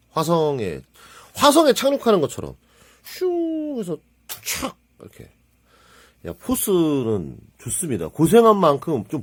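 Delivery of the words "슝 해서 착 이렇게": whoosh and landing sound. Retold or imitated. Mimics a Mars landing.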